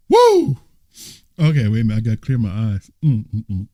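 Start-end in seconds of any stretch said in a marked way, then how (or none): 0.1-0.6 high, loud release of laughter; loud whoop. Real, not acted. Preacher shaking off his laughing fit, loud whoop. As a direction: let out a big whoop.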